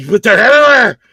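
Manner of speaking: high, loud frightened wail; retold panic. Scared man to guard lady, high panicked wail. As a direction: cry out in exaggerated alarm.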